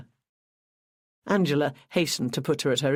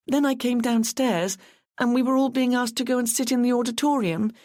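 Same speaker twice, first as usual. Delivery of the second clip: put-on higher, lighter voice for a tearful young girl; a voiced young woman, a touch anxious; young Carla; 9 semitones higher than usual. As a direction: play the nervous young runner, pitched up. Carla tells what she heard.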